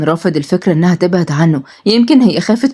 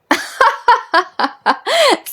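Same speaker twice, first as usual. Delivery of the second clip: high, long mocking cackle; mocking villain laugh. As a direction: laugh down at the helpless captive. Nazly laughs at captive.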